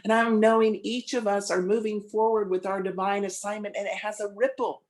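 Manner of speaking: spoken prayer. Acted. Minister leading the congregation in prayer, reverent. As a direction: lead the room inward with calm conviction.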